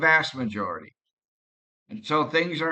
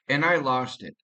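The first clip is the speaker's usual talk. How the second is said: ordinary delivery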